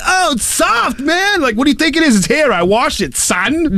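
high mock voice, settling down toward the end. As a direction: gush over it like a naive fan, then calm down. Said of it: "something stupid like".

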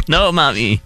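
hammed-up childish plea; whiny child. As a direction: play a kid refusing mommy. Acting a child refusing.

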